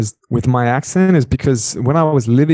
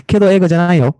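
lilting mock-polite correction; Japanese students; 7 semitones higher than usual. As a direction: imitate a politely critical student, pitched up. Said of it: "And they'd be like".

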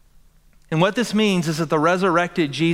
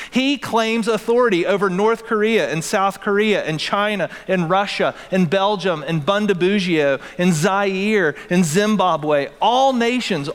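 preaching at full force; 4 semitones higher than usual. Acted. Pastor to congregation, building to a ringing climax.

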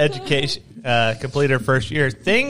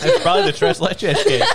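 laughing; 4 dB louder than usual. Real, not acted. Giggle fit.

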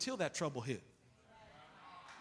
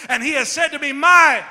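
louder congregation read; 6 semitones higher, 16 dB louder than usual. Real, not acted. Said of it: "you ain't reading like you're a lot".

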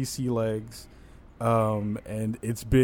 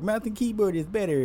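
nasal-free mock know-it-all, pitched higher; mocking gamer voice; 8 semitones higher than usual. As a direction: sneer it like a smug PC fan. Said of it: "used to be like".

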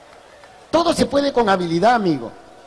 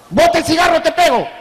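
higher, louder furious yell; angry shout; 6 semitones higher, 5 dB louder than usual. Acted. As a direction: bellow the threat at the kid. Neighbor threatens teen smoker.

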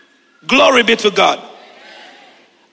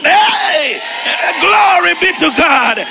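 full-force preaching; 9 semitones higher than usual. Acted. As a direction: preach it out over the music, building.

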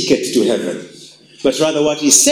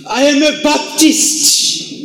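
mock stubborn Baptist; 10 semitones higher, slower than usual.

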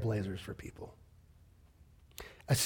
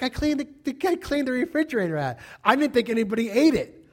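higher-pitched lightly voiced daughter; defensive daughter; 7 semitones higher, 3 dB louder than usual. Daughter explaining the cleaned refrigerator to her dad, defensive. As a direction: plead innocence in a raised pitch.

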